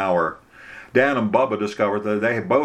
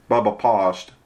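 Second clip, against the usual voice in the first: plain reading voice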